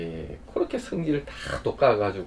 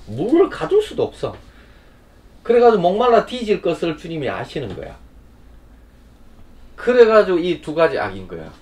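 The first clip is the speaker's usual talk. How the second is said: loud, raised, driving sermon voice; fiery preaching; 5 semitones higher, 6 dB louder than usual. Acted. Preacher rebuking those who dig broken cisterns, loud and fervent. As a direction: hammer it home to the congregation. Preaching on Jeremiah at full force.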